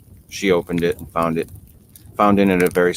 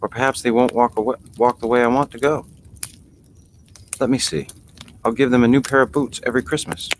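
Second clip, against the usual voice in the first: put-on raised, girlish and wondering; a child marvelling at her own feet; lightly voiced little girl Alice; young Alice voice; 7 semitones higher than usual. A narrator's reading.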